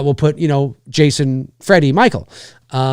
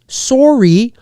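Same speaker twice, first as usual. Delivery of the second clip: high, exaggerated Canadian "sorry"; hammed-up Canadian "sorry"; Canadian accent; 8 semitones higher, 3 dB louder than usual. Shown as a demonstration. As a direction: play up the Canadian SORE-ee. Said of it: "that was very Canadian".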